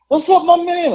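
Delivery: high, loud buddy greeting; hearty greeting. Retold or imitated. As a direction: call out to a pal across the counter. Employee greets him warmly across counter.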